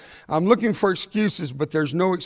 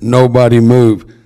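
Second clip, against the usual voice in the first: slow, loud order to freeze; retold command; 5 semitones lower, 12 dB louder, slower than usual. Soldier ordering the crew to freeze, slow and loud. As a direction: bark the order slowly to stop everyone moving. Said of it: "So I said".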